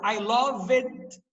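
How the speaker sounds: I loved, ending on a clear D sound; "loved" said with an added d at the end; I loved with final D. Shown as a demonstration. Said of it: "ó o Dzinho no final".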